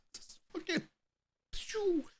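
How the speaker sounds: high squeaky whoosh for the launch; squeaky launch noise. Retold or imitated. Mimicking the raft launch.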